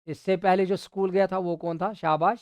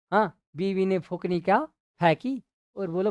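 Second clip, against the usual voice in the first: ordinary delivery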